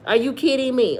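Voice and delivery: ordinary talk, nothing marked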